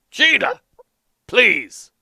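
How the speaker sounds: put-on cranky old man ranting about spoons; grumpy old farmer. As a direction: grumble like an indignant old farmer. Earl scolds wife.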